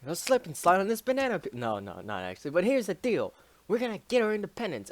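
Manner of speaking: rally them with a grin; mock leader speech. Leader addresses rebels, quoted.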